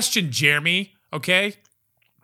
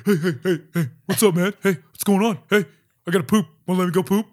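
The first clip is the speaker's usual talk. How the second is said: voicing his dog; 3 semitones higher, 4 dB louder than usual.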